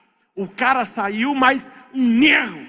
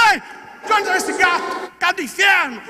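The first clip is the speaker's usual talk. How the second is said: cyclist yelling; 8 semitones higher than usual. Retold or imitated. Man clawed by cat yelling.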